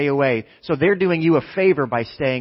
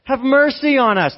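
loud, high impassioned plea; drawn-out begging yell; crying out; 10 semitones higher, 7 dB louder, slower than usual. Acted. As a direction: shout it as a desperate appeal. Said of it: "They're crying out".

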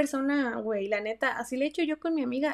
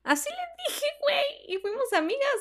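much higher squeak, half laughing; squeaky high voice; 14 semitones higher than usual. Acted. Telling viewers how the friendship began, much higher squeak.

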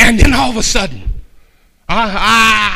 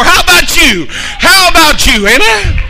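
high calling challenge; call to the crowd; 11 semitones higher, 6 dB louder than usual. Acted. Pastor challenging the church, high call. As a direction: call out to the congregation.

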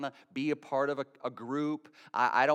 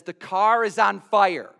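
slow, louder urgent alarm; alarmed text read out; 4 semitones higher, 9 dB louder, slower than usual. Retold or imitated. Pastor reading a congregant's text, alarmed.

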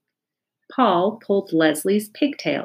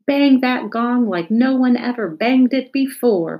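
loud encouraging call; a classmate calling out to Stephen; 6 semitones higher, 5 dB louder than usual. A narrator's reading. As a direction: call it across the room to rouse him. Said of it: "called Joy".

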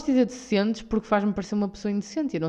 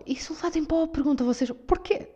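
higher-pitched, theatrical disbelief; mock astonishment; 9 semitones higher than usual. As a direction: ham up the puzzled question. Mock dramatic questioning. (espanto teatral, em tom agudo)

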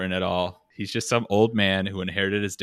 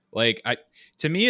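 ordinary delivery

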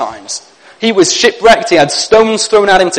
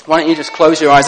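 usual speaking voice

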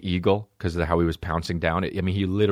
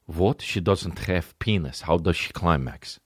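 put-on Russian accent; 3 semitones lower, slower than usual. Russian voice in quoted dialogue.

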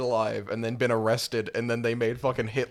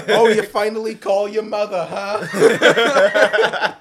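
higher, louder scolding mom, laughing; hammed-up guilt-trip mother; nagging mother; 8 semitones higher, 10 dB louder, much slower than usual. Voicing the mother on phone.

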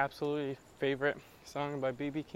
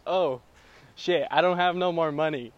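louder, mock woeful blues line; mock blues lament; 9 dB louder than usual. Showing what blues is about, louder and woeful. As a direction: wail it like a broke bluesman. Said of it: "It's more like".

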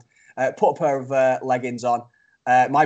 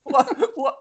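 ordinary talk, nothing marked

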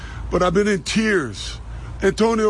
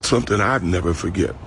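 sad voice; 10 semitones lower than usual. Acted. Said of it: "That's my sad voice".

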